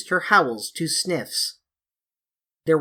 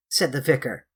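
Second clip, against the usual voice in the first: plain reading voice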